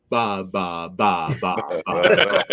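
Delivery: low, stuttered starstruck babble; speechless babbling. Retold or imitated. Retelling a friend meeting a famous artist, speechless babbling. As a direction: babble dumbly, as if struck speechless. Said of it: "she just looked and went".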